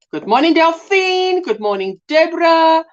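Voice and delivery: high, lilting South African greeting. Shown as a demonstration. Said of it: "typical South African way of greeting".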